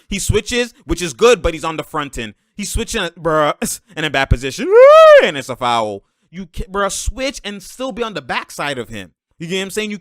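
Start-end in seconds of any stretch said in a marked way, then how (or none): 4.7-5.3 very high, loud "woo"; sudden high-pitched holler; loud whoop. Real, not acted. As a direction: let out a piercing whoop. Whooping as the foul play happens.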